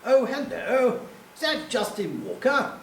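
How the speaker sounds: put-on high, plummy posh English accent; upper-crust English, pitched well up. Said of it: "this very posh voice said".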